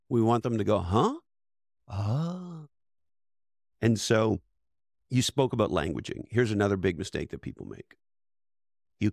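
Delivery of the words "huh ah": curious then enlightened noises. Shown as a demonstration. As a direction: go from confused grunt to a light dawning "Ah.". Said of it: "the noises we want people to make".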